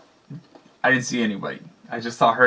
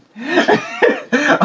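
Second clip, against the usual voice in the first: squealing laugh at own joke; giggling laugh; high laugh; 9 dB louder than usual. Real, not acted. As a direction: let a high laugh burst out. Laughing after teasing.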